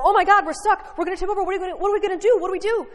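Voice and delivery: fast, high, loud panicked babbling; panicked babble. Retold or imitated. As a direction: blurt it out like a scared kid stuck in a canoe. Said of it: "I began to babble".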